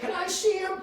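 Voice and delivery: high-pitched imitation of a lady; lady's voice. Lady asks the undertakers to see him, high imitated voice.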